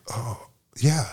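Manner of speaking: whisper. Retold or imitated. His meek realisation, acted.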